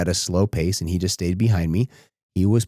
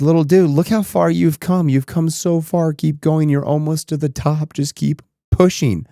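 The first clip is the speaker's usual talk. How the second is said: higher, pumped-up encouragement; rally the little one toward the top; dad cheering his son near the top of the hill; 8 semitones higher, 3 dB louder than usual. Retold or imitated. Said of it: "I just kept cheering him on".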